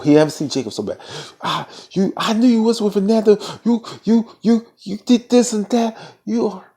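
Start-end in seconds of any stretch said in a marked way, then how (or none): 1.4-6.7 high-pitched, stammering outrage; mock angry sputtering. Jacob mad that she was with Fernando, mock sputtering. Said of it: "So Jacob was pissed off".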